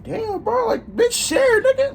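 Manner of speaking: very high-pitched exasperated complaint; exasperated demand. Retold or imitated. Smoker telling a friend to share the blunt, exasperated. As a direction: demand it in a high, worked-up squeal.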